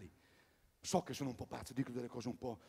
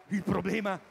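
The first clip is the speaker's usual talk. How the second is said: emphatic; 5 semitones higher, 9 dB louder than usual. Real, not acted. Repeated, building.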